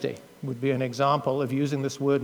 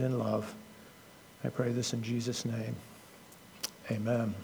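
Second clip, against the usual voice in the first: prayer. Acted. Preacher praying over the congregation, hushed and reverent. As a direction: pray humbly before the sermon. Opening prayer before sermon.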